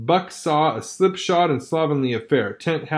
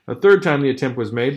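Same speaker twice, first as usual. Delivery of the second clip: ordinary delivery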